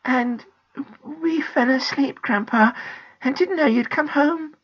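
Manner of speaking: put-on high, small child's voice; apologetic girl. A narrator's reading. Pam confessing to Grandpa, small and apologetic.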